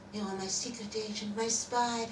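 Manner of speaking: ordinary delivery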